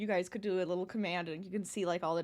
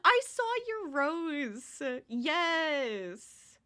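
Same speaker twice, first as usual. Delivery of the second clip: excited; 6 semitones higher, 4 dB louder, much slower than usual. Real, not acted. Thrilled at viewer's flower.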